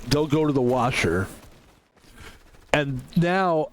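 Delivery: ordinary delivery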